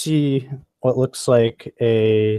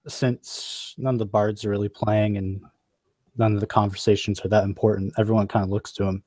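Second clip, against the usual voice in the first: normal talking voice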